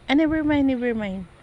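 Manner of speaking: mimicking the boss. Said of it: "Sabi niya".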